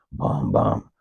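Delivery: low hummed Jaws bass notes; Jaws theme. Retold or imitated. Narrator stalking his old friend, humming Jaws music low. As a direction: hum the shark music low and ominous. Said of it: "The motherfucking Jaws music was playing".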